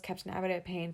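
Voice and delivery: usual speaking voice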